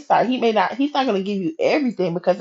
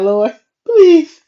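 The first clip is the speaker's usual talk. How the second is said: high, louder exasperated plea; mock pleading; 8 semitones higher, 6 dB louder than usual. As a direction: beg the Lord in comic desperation. Acts out pleading with God, joking.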